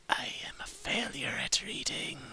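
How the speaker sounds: whispered self-mockery. Real, not acted. Reader whispering an aside about her own reading mistakes. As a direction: breathe it out as a rueful aside. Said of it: "reader's aside".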